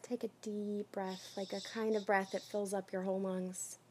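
soft, soothing breathing instruction; calming guidance. Real, not acted. Coach leading client through a deep breath, softly calming.

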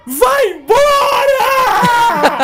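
very high, slow, laughing wail; mock tender yell. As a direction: wail it out half crying, half laughing. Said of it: "carinhosamente pra ele".

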